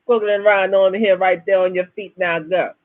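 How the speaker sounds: imitated Bahamian ladies' man; Bahamian accent. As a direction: flirt like a Bahamian bus driver. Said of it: "with that Bahamian accent".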